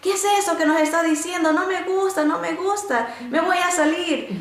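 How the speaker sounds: high-pitched mock indignation, not really shouted; retold angry outburst. Inmate protesting the teacher's words in Bible class, mock indignant. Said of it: "una de las encarceladas me empieza a gritar".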